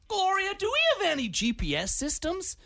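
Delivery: very high-pitched mock clerk; squeaky imitation of a desk clerk; clerk calling out. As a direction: call across to a colleague in a high voice. Clerk calls out to colleague.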